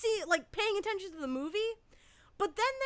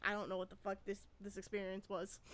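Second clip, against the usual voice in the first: much softer and lower, a bewildered mutter to herself; 8 semitones lower, 13 dB quieter, faster than usual. Retold or imitated. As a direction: sink into baffled murmur. Bewildered inner comment, very quiet.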